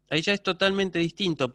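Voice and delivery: ordinary talk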